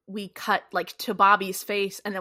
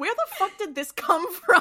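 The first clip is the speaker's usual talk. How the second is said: baffled laughing outburst; 11 semitones higher, 5 dB louder than usual. Retold or imitated. Reacting to a baffling plot turn.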